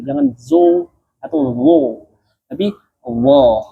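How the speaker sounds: slow "zo" rounded, then full "wo"; teacher contrasting thick letters; wrong vs right demo. Shown as a demonstration. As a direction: show the lip-rounded error slowly. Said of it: "Jangan sampai dia ketebalannya karena bibir".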